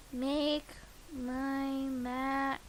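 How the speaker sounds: ordinary talk, nothing marked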